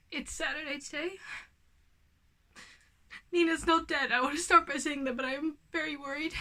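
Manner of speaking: tense, strained talk from real worry about a sick pet; holding it together while the cat is at the vet; worried and shaken. Real, not acted. Telling viewers the cat is back at the vet, worried and strained.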